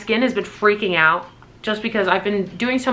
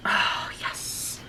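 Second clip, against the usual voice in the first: whisper; 9 dB quieter than usual. Real, not acted. Whispered delight at packaging.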